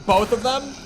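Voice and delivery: high, loud disbelieving squawk; shocked at having to do both; incredulous. Real, not acted. Streamer balks at the game's task, high and loud disbelief.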